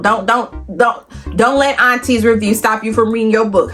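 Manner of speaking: usual speaking voice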